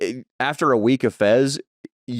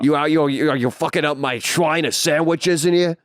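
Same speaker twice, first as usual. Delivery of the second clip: higher, slow outraged boss; angry boss; 5 semitones higher than usual. Retold or imitated. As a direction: bluster like an offended owner. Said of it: "He'll be like".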